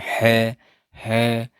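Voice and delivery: है breathed out from the throat; h sound demo. Shown as a demonstration. Teacher asks students to repeat है with her. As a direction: say है twice for class to echo. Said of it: "जैसे आप मेरे साथ बोले".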